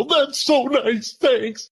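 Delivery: high, drawn-out mock tearful gush; touched and teary, hammed up; tag weepy gush. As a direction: play moved to tears by a card. Tearful at birthday cards, retold.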